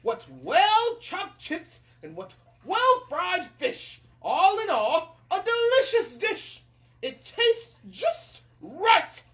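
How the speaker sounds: loud, high, drawn-out praise; delighted king. Acted. King declares the meal delicious, hammed-up delight. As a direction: savour each word grandly. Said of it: "the king declared".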